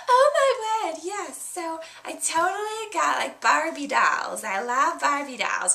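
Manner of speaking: put-on high, gushing girlish voice with a gasp and laugh; excited little girl. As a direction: squeal like a thrilled kid showing off presents. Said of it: "And she's like".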